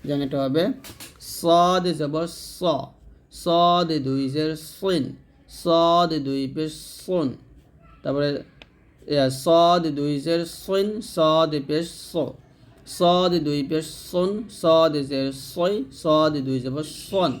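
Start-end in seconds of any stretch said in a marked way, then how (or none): 1.2-7.3 slowly drawn-out, heavy S in সদ; heavy sad, not sin. Shown as a demonstration. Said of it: "যেন সিন না হয়ে যায়". 9.3-17.3 slowly drawn-out, heavy S in সদ; heavy sad, not sin. Shown as a demonstration. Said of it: "যেন সিন না হয়ে যায়".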